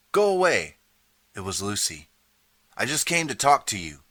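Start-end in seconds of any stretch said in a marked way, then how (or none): 0.1-0.8 much higher, loud yell through the door; shouted. Acted. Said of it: "a voice shouted". 2.8-4.0 higher, louder call through a closed door; shouted. Acted. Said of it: "I shouted through the door".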